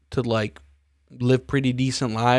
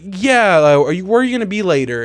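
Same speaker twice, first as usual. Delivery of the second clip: bright, eager put-on yes, higher and louder; fake friendly reply; 6 semitones higher, 6 dB louder than usual. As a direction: fake it sweetly to get rid of them. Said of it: "I was just like".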